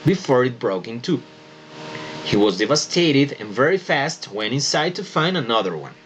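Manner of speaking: slow, careful read-aloud for pronunciation; slow model reading. Shown as a demonstration. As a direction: read it slowly so students can copy. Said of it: "So, please pay full attention". (lectura lenta y cuidadosa para que los alumnos imiten)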